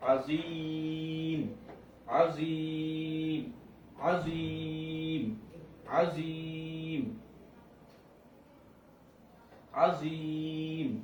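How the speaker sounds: আজিম with the long vowel stretched three counts; drawn-out madd. Shown as a demonstration. Tutor demonstrating madd for the class, stretched. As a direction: hold the ই in আজিম long, then stop on ম. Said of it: "এখন যদি ওয়াকফ করি".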